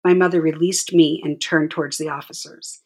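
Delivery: plain reading voice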